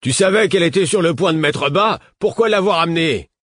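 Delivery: loud, high, exasperated reproach; swearing. Acted. Man in the crowd cursing the cow woman, angry. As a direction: curse them out in frustration. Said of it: "et quelqu'un d'autre jura".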